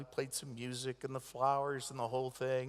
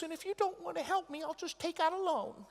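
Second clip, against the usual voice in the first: mock mom voice; 13 semitones higher than usual. Mother voiced, half laughing.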